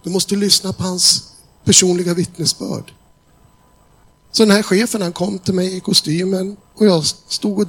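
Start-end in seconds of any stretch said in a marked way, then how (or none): 0.0-2.9 high, eager calling across the hall. Retold or imitated. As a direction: wave them over with your voice. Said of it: "började den här killen ropa".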